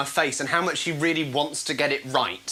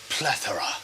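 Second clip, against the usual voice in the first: low, quiet, grave single word; solemn stranger; 10 semitones lower, 5 dB quieter than usual. Acted. Stranger at the funeral says one word, low and solemn. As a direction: drop the voice deep for a mock-solemn eulogy.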